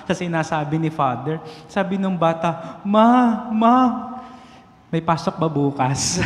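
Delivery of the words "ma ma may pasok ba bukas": a hint of a scared child's voice; lightly voiced frightened kid asking mom; trembling child. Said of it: "nanginginig ho yung bata". (bahagyang nanginginig na boses ng bata)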